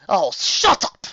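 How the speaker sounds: loud, high scornful snap; irritated dark queen; dismissive hiss. A narrator's reading. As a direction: cut her sister off with contempt. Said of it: "hissed the other Alicorn".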